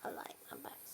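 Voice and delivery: whispered low dog voice; voicing the dog. Mother voicing her dog enjoying a back scratch, whispered. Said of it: "she says".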